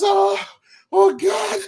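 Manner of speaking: high, breathless cry of relief; panicked relief. Retold or imitated. The monkey, safe up the tree, cries out in high relief. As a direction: gasp it out like you just escaped.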